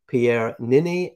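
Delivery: ordinary talk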